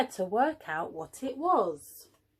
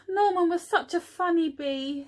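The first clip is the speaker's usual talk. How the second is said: a wistful sigh then a higher, missing-him line; sighed, mildly sad; 6 semitones higher than usual. A narrator's reading. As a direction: sigh for the lost friend. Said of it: "sighed the bees".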